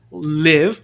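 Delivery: LIVE with a lax vowel, near "lev"; short I in live. Shown as a demonstration. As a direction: say live to rhyme with give. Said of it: "não tô falando de live".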